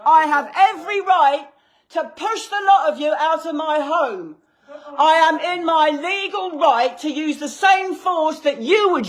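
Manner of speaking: shouting her legal rights at them; shaking with anger. Real, not acted. Furious homeowner shouting at police.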